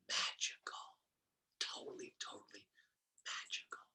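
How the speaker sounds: whispered very softly, with pauses; awed whisper. Real, not acted. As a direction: whisper it like a magical secret. Savoring the magic of numerology.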